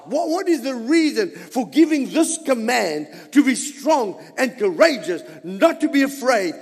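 emphatic, driving sermon delivery; rally the congregation with punched phrases; forceful preaching. Real, not acted. Preacher urging brothers and sisters to be strong, forceful preaching.

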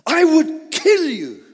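Balaam threatening to kill the donkey; angry threat. Retold or imitated. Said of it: "There was no respect".

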